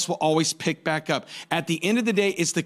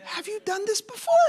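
mock alarm; 5 dB quieter than usual. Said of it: "And I'm like".

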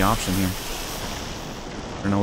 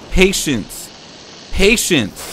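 higher, louder insistent patience; loud self-urging; 7 semitones higher, 4 dB louder, much slower than usual. Real, not acted. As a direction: press it on yourself, louder. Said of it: "Patience. repeated louder".

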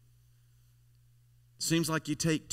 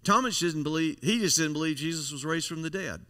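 normal talking voice

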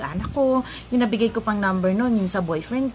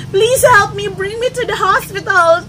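high, loud desperate plea; pleading in English; 10 semitones higher, 8 dB louder, slower than usual. Retold or imitated. To the gathering men, a loud English plea. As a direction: beg to be taken to the hospital. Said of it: "Umi-English pa nga ako".

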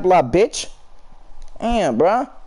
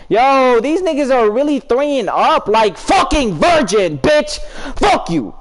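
raging shout; 4 semitones higher, slower than usual. Real, not acted. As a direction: blow up at the other team. Raging at opponents in game.

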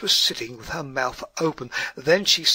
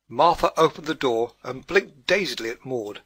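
ordinary delivery

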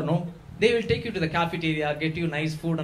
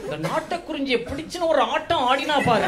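excited Tambram mama; 9 semitones higher, 3 dB louder, much faster than usual. Retold or imitated. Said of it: "Tambra mamas".

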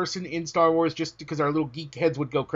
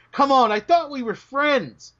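mock hurt; 7 semitones higher, 3 dB louder, slower than usual.

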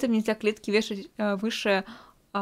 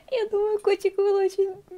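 laughing, high-pitched; 8 semitones higher than usual. Real, not acted. Laughing high over the cat.

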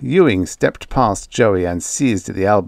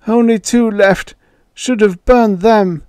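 put-on high, loud old man's protest; 13 semitones higher, 6 dB louder than usual. A narrator's reading. Ewing protesting to Joey, high and loud. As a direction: object in a frail high voice.

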